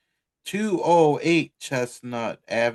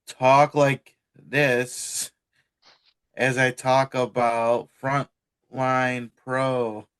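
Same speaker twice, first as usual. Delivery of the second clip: slow, drawn-out delivery; slow talk; slower than usual. Shown as a demonstration. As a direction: stretch every word out slowly. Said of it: "I'm going to".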